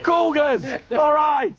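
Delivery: shouted correction. Real, not acted. Correcting the co-host's misread team name with a loud shout. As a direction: bellow the word out in triumph.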